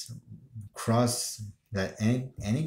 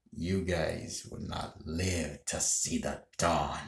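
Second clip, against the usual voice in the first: slow, lowered villain voice; Wesker; 3 semitones lower, 4 dB quieter, slower than usual. Acted. Gamer quoting a game villain's voice line, slow Wesker imitation. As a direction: deliver a measured, deep-voiced villain's threat. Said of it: "just doing Wesker's voice line".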